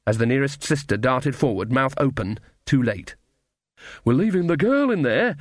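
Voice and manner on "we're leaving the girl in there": high, mild narrated shout. A narrator's reading. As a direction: call out high without much volume. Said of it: "shouted Nanny".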